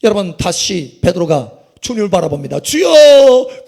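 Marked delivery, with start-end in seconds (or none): 2.6-3.6 long, high, loud drawn-out plea; desperate cry. Acted. Peter crying to the Lord to save him, desperate cry. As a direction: call out for rescue, stretching the word.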